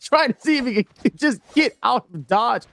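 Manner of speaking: energetic caster commentary, rising as the combo lands; live play-by-play. Real, not acted. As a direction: call the action and build excitement with each hit.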